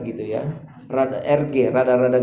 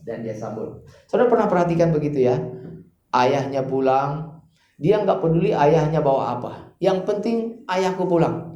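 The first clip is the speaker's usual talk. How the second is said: ordinary talk, nothing marked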